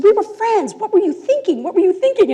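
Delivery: put-on high, pressing demand from the girl; teen girl confronts the boy who harmed her, high and pressing; confronting him. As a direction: voice a hurt teen calling him out. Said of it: "And she said".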